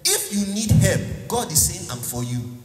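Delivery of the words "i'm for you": soft, lower, tender promise; tender reassurance. Acted. Preacher softly voicing God promising help. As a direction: say it gently, close. Jesus comforting quietly.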